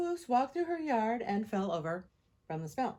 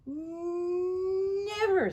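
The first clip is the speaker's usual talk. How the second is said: long, high, loud stubborn never; defiant never; 11 semitones higher, 6 dB louder than usual. A narrator's reading. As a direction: dig in your heels, drawn out. Tina defiant to her mother.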